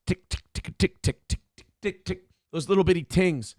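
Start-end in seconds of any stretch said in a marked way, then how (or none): 0.1-2.2 soft clicking tick tick sounds; imitating a blinker's tick; mimicked blinker ticking. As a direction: click out a turn signal with the tongue. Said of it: "Yours goes tick".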